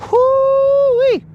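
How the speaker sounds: sudden high falsetto whoop; a quick high "whoo-wee" holler. Real, not acted. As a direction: let out a yelp of glee. Excited holler after name.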